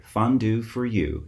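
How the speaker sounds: plain reading voice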